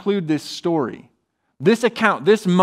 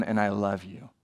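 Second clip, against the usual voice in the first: tender; 7 semitones lower, 8 dB quieter than usual. Acted. Jesus' tender words voiced.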